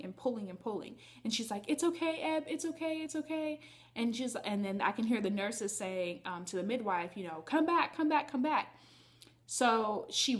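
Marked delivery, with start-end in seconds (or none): 1.7-3.6 soothing sister. Retold or imitated. Sister soothing her in labor. 7.5-8.8 urgent call. Retold or imitated. Nurses calling the midwife back.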